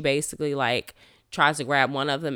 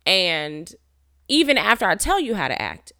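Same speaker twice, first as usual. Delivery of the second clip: angry; 6 semitones higher, 3 dB louder than usual. Retold or imitated. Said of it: "really angry because I'm like".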